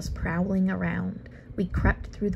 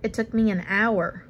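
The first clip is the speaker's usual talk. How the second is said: ordinary delivery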